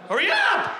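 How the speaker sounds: high-pitched, exasperated holler; impatient yell. Acted. Waiting customer yelling at slow kitchen staff, high and impatient. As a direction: yell it at the top of the voice. Same order repeated much higher.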